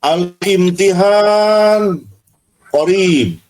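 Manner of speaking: "Al-imtihan" stretched very slowly, then "qorib"; drawn-out demonstration. Shown as a demonstration. Teacher shows how it usually goes, slow drawn-out "Al-imtihan qorib". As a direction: draw out each long vowel of "Al-imtihan qorib". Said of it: "Biasanya begini".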